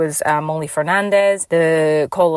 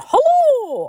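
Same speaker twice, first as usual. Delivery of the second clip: Hello pitched very high and drawn out, then falling; signature podcast hello; 4 dB louder than usual. Shown as a demonstration. Greeting listeners with her Ian-style high Hello. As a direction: sing out a high, stretched Hello. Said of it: "the way that I introduced the podcast".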